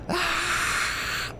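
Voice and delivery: breathy whisper yell; a breathy half-yell on ah. Real, not acted. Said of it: "The breathy, breathy whisper yelling".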